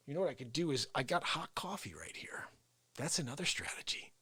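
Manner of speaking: unvoiced scheming whisper; conspiratorial whisper. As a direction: plot it under the breath. Plotting against Face.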